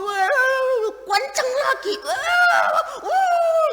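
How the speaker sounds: high, loud mock shouting; mock yelling in a squeaky voice; mocking shouter. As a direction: ham up an angry shouter yelling back. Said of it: "Dia ngomong".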